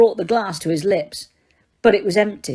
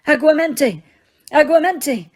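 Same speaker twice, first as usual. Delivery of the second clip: desperate casting; 7 semitones higher than usual. Acted. Repeated desperate spell.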